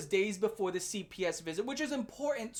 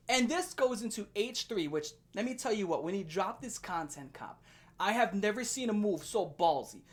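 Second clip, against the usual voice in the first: ordinary talk, nothing marked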